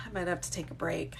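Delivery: softly whispered through tears; real tearful whisper. Real, not acted. Overwhelmed by viewers' kind comments, a tearful whisper. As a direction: let the tears thin the voice to a whisper. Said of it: "I'm crying".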